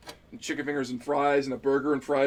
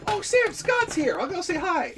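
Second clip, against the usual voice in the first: much higher, slow, sing-song excited quote; mock excited chirp; 3 dB louder, slower than usual. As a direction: play it as an over-eager fan, pitched way up. Retelling her own words to Sam.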